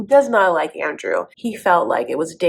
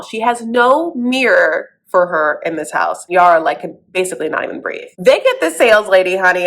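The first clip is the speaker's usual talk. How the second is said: ordinary talk, nothing marked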